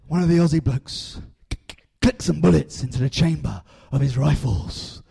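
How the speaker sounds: storyteller building to a loud climax; story narration. Acted.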